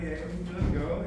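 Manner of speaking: normal talking voice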